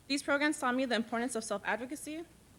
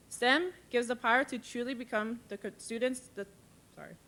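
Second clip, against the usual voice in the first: normal talking voice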